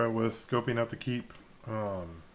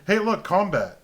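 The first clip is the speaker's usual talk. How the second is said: higher and louder, a playful mock call-out; mock announcement; 8 semitones higher, 8 dB louder than usual. Teasing a friend about instant combat, mock excited call-out. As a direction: announce the fight like a sarcastic herald. Mocking the sudden first-session combat.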